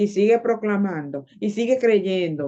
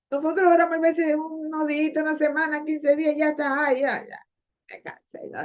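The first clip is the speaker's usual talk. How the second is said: mock giving up; 7 semitones higher than usual. Mimics believers quickly giving up on prayer.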